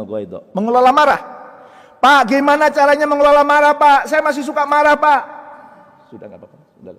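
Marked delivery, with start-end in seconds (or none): 2.0-5.2 high, loud mock plea; congregant's plea. Voicing a congregant's pleading question. 6.1-6.9 soft, low reassurance; calm and very quiet; soothing reply. Acted. Soothing the pleading congregant.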